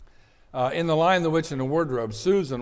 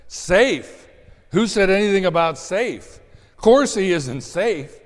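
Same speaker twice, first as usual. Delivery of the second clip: louder, indignant retort as Mister Beaver; 6 semitones higher, 7 dB louder than usual. Acted. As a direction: fire the word back in disbelief. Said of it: "Mister Beaver replied".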